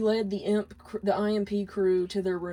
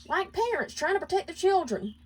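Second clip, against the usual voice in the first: much higher voice, curt retort; snapping back; 11 semitones higher than usual. Acted. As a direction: snap the line back at him. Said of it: "Millie snapped back at him".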